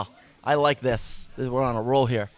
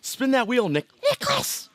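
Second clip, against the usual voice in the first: high call; 9 semitones higher than usual. Acted. Host commanding spin.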